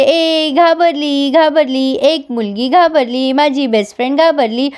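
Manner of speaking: drawn-out, mocking singsong; teasing taunt. Chhabi taunting her scared carer Pinky, teasing singsong. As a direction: stretch each phrase like a playground taunt.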